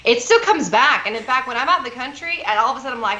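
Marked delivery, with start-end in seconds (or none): none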